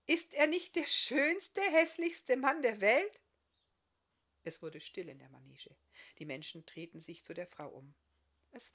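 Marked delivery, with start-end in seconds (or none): 0.0-3.2 laut, hoch und gedehnt gerufen; begeisterter Ausruf. Acted. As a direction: ruft es strahlend ins Publikum. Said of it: "rief sie begeistert". (begeistert laut rufend)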